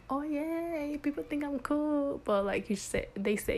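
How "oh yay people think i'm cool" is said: put-on squealed happily, much higher, like a flattered kid; naive delight. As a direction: her younger self thrilled at a compliment, high excited squeal. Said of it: "I used to be like".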